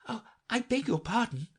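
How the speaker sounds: humble apology. A narrator's reading. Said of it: "said Alice very humbly".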